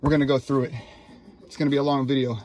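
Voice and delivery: ordinary delivery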